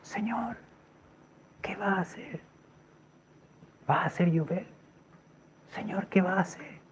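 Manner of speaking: whispered prayer. Retold or imitated. Man praying to the Lord after the wind, whispered. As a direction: whisper it privately to God.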